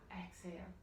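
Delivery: soft whispered exhale cue. Shown as a demonstration. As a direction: breathe the word out in a whisper. Teacher cues breath out.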